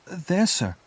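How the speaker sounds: put-on high-pitched little child's answer; timid boy. A narrator's reading. Boy points to his mother's grave, timid.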